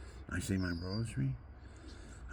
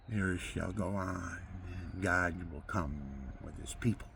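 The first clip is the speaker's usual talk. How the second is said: prophetic preaching; 4 dB quieter than usual. Acted. Prophetic sermon about doom.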